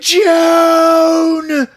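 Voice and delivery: high, loud, drawn-out scream of a name; hammed-up frightened yell; terrified calling. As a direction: wail for a wife in mock terror. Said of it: "He's, like, so terrified".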